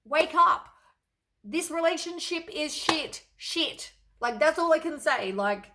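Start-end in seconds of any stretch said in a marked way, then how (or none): 0.0-3.9 universe scolding. Retold or imitated. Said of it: "The universe is like".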